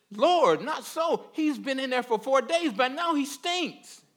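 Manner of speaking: high-pitched, hammed-up objection; mock protest. Preacher playing the sister objecting to opening the tomb, high protest.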